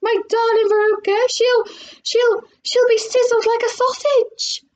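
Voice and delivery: high, frantic wail; yelled. A narrator's reading. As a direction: splutter in horror for your child. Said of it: "yelled Missus Salt".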